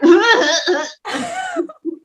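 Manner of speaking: high, overdone fake sob; mock sobbing. Mocking actors who sob on their reels, overdone fake sob. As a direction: imitate an actor's showy crying. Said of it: "one minute of them going".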